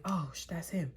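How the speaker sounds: sharp unvoiced whisper; panicked whisper. Seeing the ex at Walmart, alarmed whisper. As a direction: hiss it so he can't hear. Spotting ex in parking lot.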